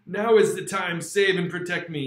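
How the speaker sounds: put-on a desperate plea called out to the old man; crying out. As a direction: beg urgently for protection. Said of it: "I cried".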